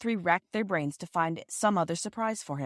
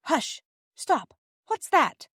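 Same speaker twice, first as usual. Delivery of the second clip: sharp, much higher urgent calls; alarmed hush; 10 semitones higher, 5 dB louder, much slower than usual. Acted. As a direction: stop everyone at once, startled. Bobby hears strange noise.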